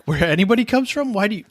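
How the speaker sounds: ordinary talk, nothing marked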